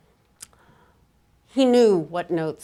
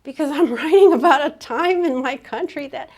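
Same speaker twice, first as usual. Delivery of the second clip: higher and louder, a laughing, emphatic comeback; quoted with a laugh, pitched up and loud; defiant retort; 8 semitones higher, 7 dB louder than usual. Retold or imitated. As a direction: fire back that you refuse to make it pretty. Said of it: "And he's like".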